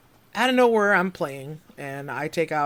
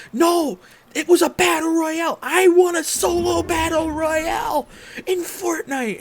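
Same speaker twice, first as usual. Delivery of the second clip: excited insisting; 7 dB louder than usual. Retold or imitated. Insisting to uncle it matters.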